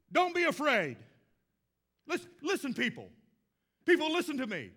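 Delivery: loud proclaiming Heston impression; Charlton Heston impression. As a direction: thunder it like Heston's Moses. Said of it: "it's really not a good Heston imitation".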